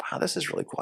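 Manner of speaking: breathy hushed wonder, high; an amazed whisper to yourself; awed whisper. Retold or imitated. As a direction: whisper it like you can't believe it. Recalling excitement at the video.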